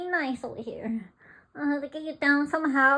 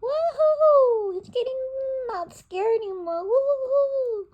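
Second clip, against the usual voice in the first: cheering; 8 semitones higher, 7 dB louder, slower than usual. Real, not acted. Cheering the bird on.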